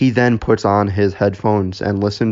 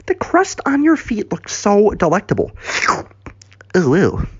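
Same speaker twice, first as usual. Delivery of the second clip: high, creepy swooning; drooling admiration; 10 semitones higher than usual. Acted. Dougie admiring the crust on Bozo's feet, fawning. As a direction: savour it in a high voice with little oohs.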